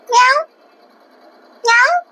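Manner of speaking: high, rising cat meow; imitating a meow. Meowing back at the cat.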